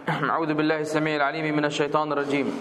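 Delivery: melodic chanted recitation of the verse; تلاوة مجودة للآية; Quran recitation. Acted. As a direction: intone it with measured tajweed melody.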